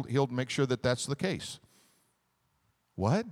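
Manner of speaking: normal talking voice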